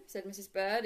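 plain reading voice

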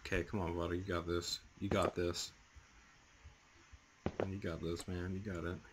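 soft muttered self-encouragement; quietly talking himself up; self pep talk. Real, not acted. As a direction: coach yourself under your breath.

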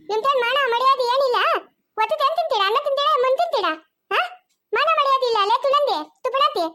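high-pitched, indignant tirade; angry scolding. Acted. Relative shaming the women for having no self-respect, angry scolding. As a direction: fire off scornful questions in a high voice. Acted scolding in family quarrel.

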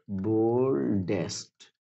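"Boldest" stretched into two slow beats; slow dictation. Shown as a demonstration. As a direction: draw out "boldest" syllable by syllable. Teacher dictates the superlative slowly. ("Boldest" खींचकर, धीरे-धीरे)

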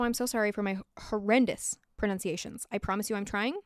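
ordinary talk

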